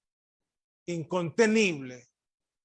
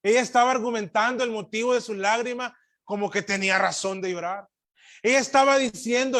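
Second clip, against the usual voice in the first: loud, quick, higher preacher's voice; emphatic preaching; 6 semitones higher, 9 dB louder, faster than usual. Real, not acted. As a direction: argue it forcefully from the pulpit. Preacher argues forcefully.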